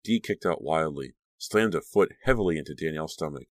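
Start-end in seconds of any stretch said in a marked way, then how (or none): none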